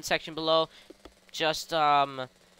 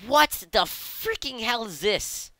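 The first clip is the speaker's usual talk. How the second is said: slow, higher-pitched, word-by-word amazement; drawn-out disbelief; 5 semitones higher, slower than usual. Acted. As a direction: stretch "What" and "freaking" incredulously. Mock amazement at the vehicle.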